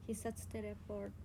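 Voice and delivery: usual speaking voice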